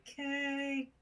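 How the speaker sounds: high, drawn-out little call; restrained holler, held on one high note; quiet yell back. Acted. Character yells back to her companion, sort of quietly. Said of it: "I'll yell back sort of quietly".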